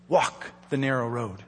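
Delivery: hushed charge. Acted. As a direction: drop the voice to a near-hush on walk the narrow road. Repeated charge, first hushed.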